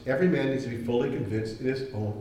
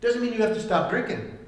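Paul questioning; 6 semitones higher, faster than usual. Retold or imitated. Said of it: "Paul says".

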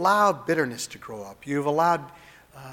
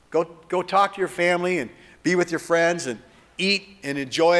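rousing send-off; 3 semitones higher, 5 dB louder than usual. Acted. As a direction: send them out with a happy push. Upbeat send-off preaching.

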